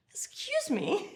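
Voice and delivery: high-pitched, laughing mock affront; laughing indignation. Woman told to sit on card, laughing indignation. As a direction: squeal it through a scandalized laugh. Reacts to magician's cheeky request.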